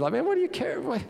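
high-pitched, incredulous questioning; what-is-it-to-you exasperation; exasperated challenge. Acted. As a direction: plead his case in rising indignation. Challenging Michal's scorn of David.

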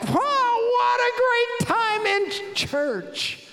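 loud, very high, hammed-up elation; whooping delight, slowed and stretched out; overjoyed. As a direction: burst in bubbling over with happiness. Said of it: "He's all happy".

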